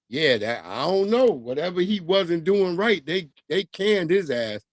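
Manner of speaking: usual speaking voice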